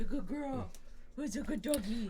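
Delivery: soft cooing pet voice; sweet talk to the dog. Real, not acted. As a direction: coo at the dog. Second speaker coos at dog.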